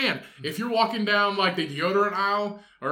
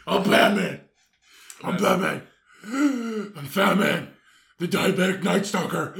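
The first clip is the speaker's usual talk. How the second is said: hoarse, louder fat-superhero rasp; Batman; 3 semitones higher, 4 dB louder, slower than usual. Acted. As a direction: announce yourself as a wheezing vigilante. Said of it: "I get my best Batman voice".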